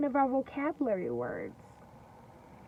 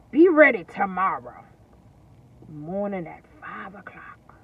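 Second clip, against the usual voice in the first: sly wolf; 4 semitones lower, 7 dB louder than usual. Acted. Wolf tricking the pig.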